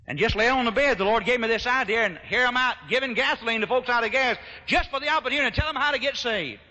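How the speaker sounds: high, loud preacher's cadence; full-force preaching. Retold or imitated. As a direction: proclaim it like an old-fashioned gospel preacher. Preaches his own retold reply.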